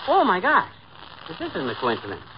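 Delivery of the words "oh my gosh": higher-pitched startled exclamation; sudden amazed outburst; astonished. Acted. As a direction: gasp it out in astonishment. Reading surprising telegram.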